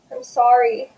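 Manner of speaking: plain reading voice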